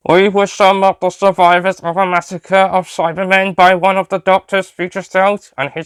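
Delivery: robot voice. Acted. Cyberman explains survival.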